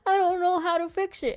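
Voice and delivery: high, whiny mock of clueless coworkers; a mock whine imitating coworkers who can't solve it; mocking helpless voice. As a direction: play them as hopeless and dim.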